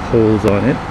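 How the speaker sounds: usual speaking voice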